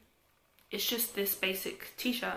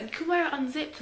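higher-pitched imitation of mum; mum's voice; 7 semitones higher, faster than usual. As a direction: quote mum in a raised pitch. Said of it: "Mum was like".

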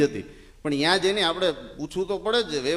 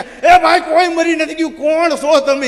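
loud, high alarmed yell; shocked man hollering; startled shout; 11 semitones higher, 11 dB louder than usual. Retold or imitated. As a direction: shout at the intruders. The startled sahib shouts at the crowd.

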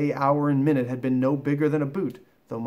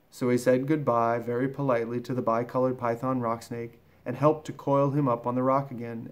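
ordinary delivery, nothing marked

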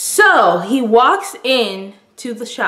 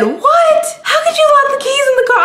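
much higher, fast incredulous squawk; mock his disbelief in a shrill-high pitch; imitating the boyfriend's high disbelieving outburst; boyfriend's outrage; 3 dB louder than usual. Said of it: "He said".

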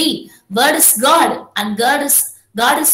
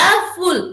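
"a full" pitched high with weight on "full"; teacher marking the beat on "full"; stressed syllable demonstration; 4 semitones higher than usual. Shown as a demonstration. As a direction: lean hard on "full" after a light "a".

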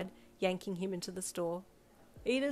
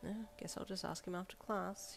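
inner thought; 7 dB quieter than usual. A narrator's reading. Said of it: "you thought".

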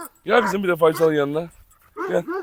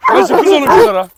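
egging the dog on; 10 semitones higher, 8 dB louder than usual. Real, not acted.

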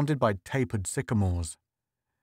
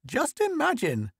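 put-on very high, marvelling old lady; excited old woman. A narrator's reading. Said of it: "The old woman's eyes glowed".

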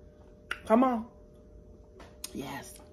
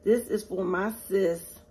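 ordinary delivery

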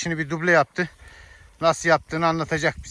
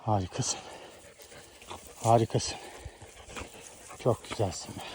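talking to a dog; 9 semitones lower, 13 dB quieter than usual. Real, not acted. Tender praise to the dog.

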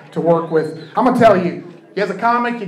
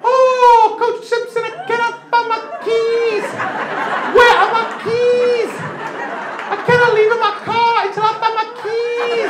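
very high falsetto, drawn out; slowed-down impression. Comic slowing the mother's plea down for the crowd, high and slow. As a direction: stretch every word, high and pleading. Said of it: "I'll slow it down for you".

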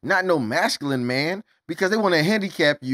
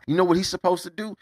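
usual speaking voice

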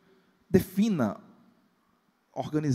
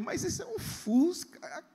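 high, slow, incredulous; mock dismay; 14 semitones higher, 4 dB quieter, slower than usual. Pastor imitating a man stunned by a battered Fusca, incredulous. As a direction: drag it out in disbelief. Man shocked at old car.

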